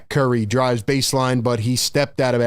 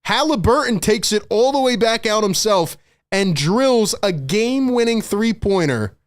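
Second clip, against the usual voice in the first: excited call; 7 semitones higher than usual. Real, not acted. Game-winning shot call.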